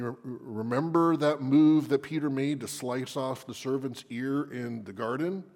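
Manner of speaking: ordinary delivery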